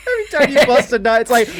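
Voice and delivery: words breaking into high laughter; laughing. Real, not acted. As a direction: laugh through the punchline. Laughing at the joke.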